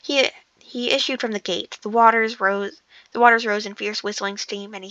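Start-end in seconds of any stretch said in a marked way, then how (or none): none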